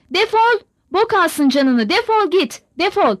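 high, loud yell of defol; a woman shrieks at her father-in-law to get out; furious shout. Acted. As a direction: scream him out the door. Aksinya drives the old man out.

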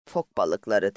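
ordinary delivery, nothing marked

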